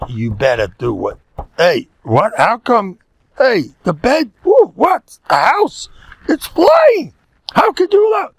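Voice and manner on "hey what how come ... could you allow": high, loud, shocked inspecting voice; scolding mother. Retold or imitated. Mother inspecting daughter's house, high loud appalled scolding. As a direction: shriek at every fault, high and loud. Voicing a critical mother-in-law.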